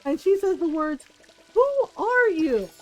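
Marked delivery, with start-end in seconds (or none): 1.5-2.7 slow, high, stunned question; mother stunned by her son's bullying, high shocked gasp of a question; shocked disbelief. Retold or imitated. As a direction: ask as if you no longer recognise your own son. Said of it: "she was shocked".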